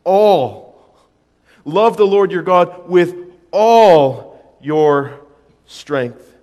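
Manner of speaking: "all" hammered slow and loud each time; stress on all. Shown as a demonstration. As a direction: lean hard on every "all", slowly. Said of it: "The word is repeated for us four times".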